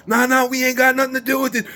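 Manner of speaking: high-pitched imitation of alarmed bystanders; mock alarmed denial. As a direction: plead no involvement in a high voice. Bystanders disowning the fight, retold.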